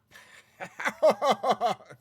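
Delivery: loud, high burst of evil laughter. Real, not acted. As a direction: cackle like a villain, spontaneously. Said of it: "Spontaneous evil laughter".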